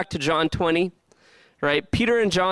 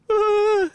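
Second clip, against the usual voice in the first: high, loud imitated crying wail; acted high-pitched bawling; mock inconsolable wail; 4 dB louder than usual. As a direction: wail out a hammed-up sob.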